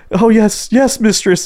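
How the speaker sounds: high, eager little yes mistress; high-pitched mock servant; submissive client voice. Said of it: "he's just like".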